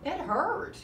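ordinary delivery

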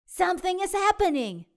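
high, loud and fast, bursting out; excited. A narrator's reading. Pete watching the pupa wiggle, excitedly calling out. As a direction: blurt it out in a rush of excitement. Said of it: "says Pete".

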